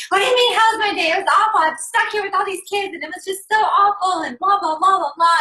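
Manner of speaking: high, loud exasperated rant; hammed-up frazzled wife venting; loud high complaining about the kids; mock crazed rant. Wife snapping back at husband.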